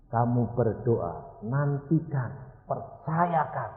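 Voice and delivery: ordinary delivery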